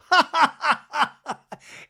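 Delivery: real amused cackle; big high laugh; loud high laugh. Real, not acted. Laugh after mocking quote.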